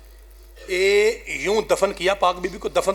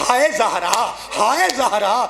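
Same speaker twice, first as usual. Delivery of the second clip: retold lament; 5 semitones higher than usual. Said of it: "جب کہا نا".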